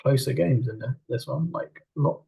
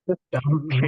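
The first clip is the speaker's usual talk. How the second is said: unsure; 3 semitones higher than usual. Real, not acted. Co-host admitting he is lost on the league, unsure. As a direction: say it with real uncertainty. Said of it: "You sounded a little unsure there".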